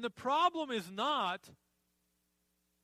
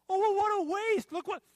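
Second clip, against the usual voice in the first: high, loud mock dismay; acted outcry, pitch jumping up; appalled; 11 semitones higher, 8 dB louder than usual. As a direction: exclaim like an onlooker shocked at waste. Imagined onlooker reacting to waste.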